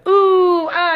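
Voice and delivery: high, loud mock crowd "ooh"; imitated teasing chorus; friends' jeering ooh. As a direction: hoot like friends watching a rejection. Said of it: "All his friends went".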